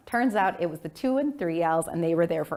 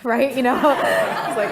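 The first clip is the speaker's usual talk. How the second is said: usual speaking voice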